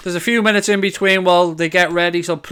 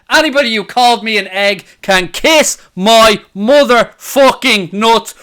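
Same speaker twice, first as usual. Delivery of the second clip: defiant gloating; 4 semitones higher, 6 dB louder than usual. Real, not acted. Defiant gloating after goal.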